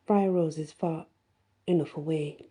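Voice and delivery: ordinary delivery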